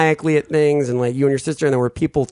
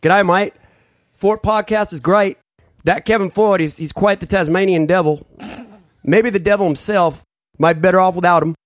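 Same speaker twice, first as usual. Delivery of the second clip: higher-pitched, hammed-up Aussie accent; amateur Australian accent, pitched up; 5 semitones higher than usual. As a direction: read it as a not-that-good Australian impression. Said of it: "an Australian accent".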